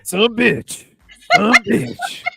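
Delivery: high, loud Southern exclamation run together; punchy Southern "son of a bitch", words mashed into one; Southern "sumbitch". Shown as a demonstration. Host shows off his Southern phrase, loud Southern slur. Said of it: "that's my Southern".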